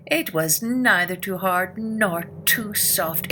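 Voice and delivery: ordinary delivery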